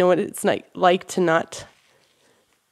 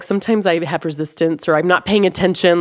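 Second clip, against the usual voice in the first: normal talking voice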